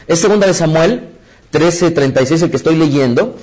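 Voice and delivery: normal talking voice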